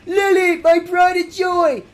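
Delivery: high, loud, slow proud shout; trainer voice. Acted. Opponent trainer sending out his favourite, loud and proud.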